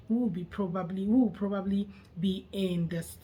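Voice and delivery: usual speaking voice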